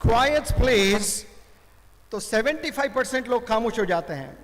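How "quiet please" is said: commentator's drawn-out second hushing call, lightly acted; changed tone. Commentator to those still making noise, changed tone, lightly acted. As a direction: stretch both words into a measured announcement. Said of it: "tone change करके कहता है".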